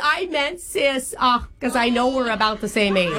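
hammed-up Long Island-Boston-Minnesota mom accent; horrible jumbled accent. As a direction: play a chatty older girlfriend in a bad regional accent mash-up. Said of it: "doing a horrible accent".

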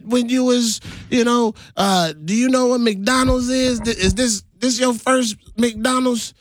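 high, jeering imitation of bullies; mocking bullies. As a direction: taunt him like a schoolyard bully. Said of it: "bullies taunting him".

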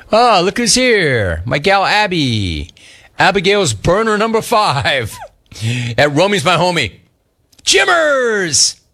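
high-pitched announcement with stretched words, peaking on a cry; showy greeting read. Acted. Host hails Abby and her email, in a high showy read. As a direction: build up to a high, drawn-out call.

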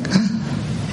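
sharp unvoiced gasp of awe; awed gasp. Cashier gasping at a famous customer, awed gasp. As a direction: gasp like you've seen a legend.

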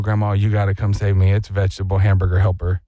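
urgent low-voiced call for rescue; pleading grandkid on phone. Grandkid calling grandma to be saved, low urgent plea.

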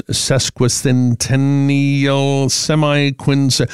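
"sesquicentennial" drawn out very slowly, syllable by syllable; sounding out. Real, not acted. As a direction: stumble slowly through "sesquicentennial". Sounding out an unfamiliar long word.

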